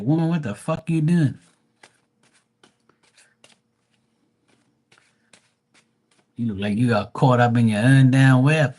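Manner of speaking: loud teasing rant; mock scold. Retelling telling the woman off about her outfit, loud mock scold. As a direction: scold her like she should know better.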